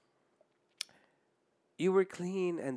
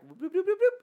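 bloop sound effect; 3 dB louder than usual. Pretend. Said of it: "sound effect while backtracking".

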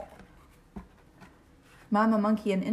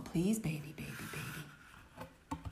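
whisper; 7 semitones lower, 10 dB quieter, slower than usual. Acted. Refrain whispered in story.

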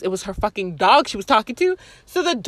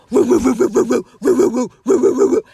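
imitated dog barking; 5 dB louder, faster than usual.

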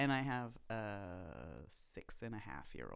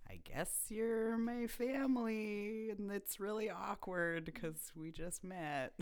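high, slow, awkward mock voice to dad; half-sung awkward aside, drawn out; awkward inner voice; 12 semitones higher, 5 dB louder, slower than usual. Voicing awkward thought to dad.

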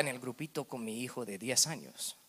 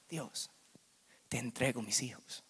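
ordinary talk

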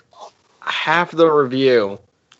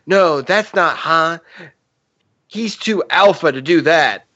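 lightly raised grape voice, slower; a hint of the sing-song berry; Member Berries voice; 5 semitones higher than usual. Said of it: "Try it again".

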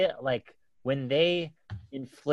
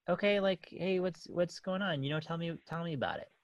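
higher but much softer, tender questions; soft gentle asking; 4 semitones higher, 8 dB quieter than usual. Retold or imitated. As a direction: invite her to open up softly. Soft talk with upset daughter.

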